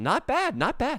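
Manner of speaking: high, impressed "not bad". Real, not acted. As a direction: sound pleasantly impressed. Impressed reaction.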